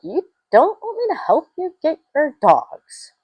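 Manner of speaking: high, baffled question; retelling her own bewildered reply; confused disbelief. Retold or imitated. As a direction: ask it in stunned disbelief. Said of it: "I'm like".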